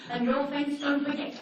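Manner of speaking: a hint of a fussy neighbour; nagging neighbour.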